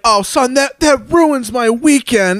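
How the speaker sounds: high, slow mock lament; guilt-tripping dad. Dad tells son his weekend is ruined, guilt-tripping. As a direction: lay the guilt on as an imitated father. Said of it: "guilt tripped".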